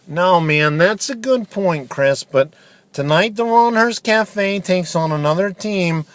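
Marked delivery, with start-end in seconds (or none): none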